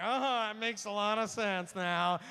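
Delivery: big drawn-out dawning realisation; loud realisation. Acted. Speaker mimicking audience finally getting it, loud dawning realisation. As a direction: exclaim it loud and slow. Acting out listeners' sudden realisation.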